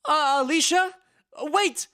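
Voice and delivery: loud, high call after her. Acted. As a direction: shout before she hangs up. Calling into dead phone.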